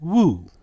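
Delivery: "Wu" with a long oo; pronunciation demo. Shown as a demonstration. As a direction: sound out "Wu" for learners. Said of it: "How is it pronounced?".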